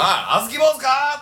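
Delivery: put-on drunk heckler. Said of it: "って言って".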